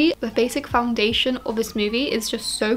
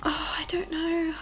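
whisper; 5 semitones higher, 8 dB quieter than usual. Real, not acted. Whispers the peek, then shrugs.

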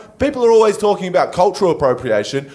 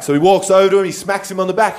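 usual speaking voice

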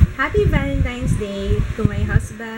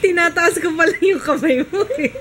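laughing while talking; 5 semitones higher than usual. Real, not acted. Tickling child, laughing while talking.

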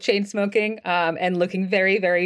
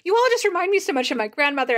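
lightly voiced flustered woman, pitched higher; flustered character; 7 semitones higher, faster than usual. Jenna to player characters who remind her of grandmother, lightly flustered. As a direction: gush it a little higher, as if flustered.

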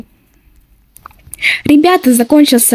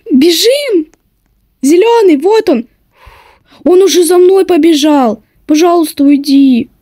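being chased; 4 semitones higher than usual. Real, not acted.

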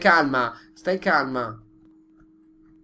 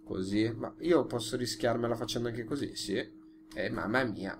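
ordinary delivery

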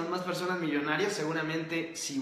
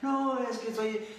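a lightly voiced whiny complainer, a bit higher; mock the excuses in a higher, whinier voice; 4 semitones higher than usual. Host mocking young viewers' excuses, mild whiny complainer. Mimicking people who complain.

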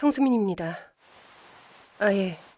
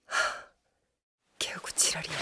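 unvoiced, softer annoyed curse; whispered curse; 5 semitones lower, 9 dB quieter than usual. Team lead swearing to herself after the work call. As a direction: hiss the swear under your breath.

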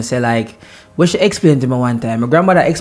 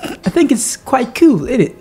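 mock British accent; 5 semitones higher than usual. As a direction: savour the British phrasing, teasing. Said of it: "give me your British accent".

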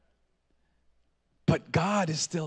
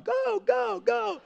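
pitched-up imitation of cheering; cheering them on; much slower than usual. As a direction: egg the kids on in a held-back high shout. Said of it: "I cheer them on".

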